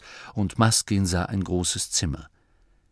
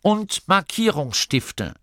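slow, high, pompous announcement; hochtrabend; 10 semitones higher, slower than usual. A narrator's reading. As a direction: proclaim each syllable with grandeur. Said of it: "erklärte Gurda hochtrabend". (gedehnt und hochtrabend verkündet)

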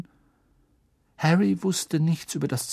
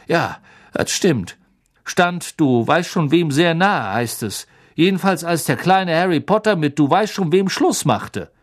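higher, louder gossipy conductor voice; chatty bus conductor; 4 semitones higher, 4 dB louder than usual. Acted. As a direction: play Stan as an eager young gossip. Stan voiced by narrator.

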